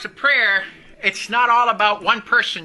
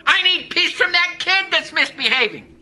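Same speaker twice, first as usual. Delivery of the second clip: high, insistent mimicked complaining; mock demanding plea; 6 semitones higher, 4 dB louder than usual. Preacher mimicking people asking God for things, raised insistent pleading.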